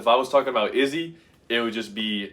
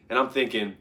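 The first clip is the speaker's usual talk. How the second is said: ordinary talk, nothing marked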